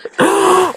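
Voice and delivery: real high laugh breaking out; sudden squealing laugh; high burst of laughter. Real, not acted. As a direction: laugh up high at your own story. Laughing at own story.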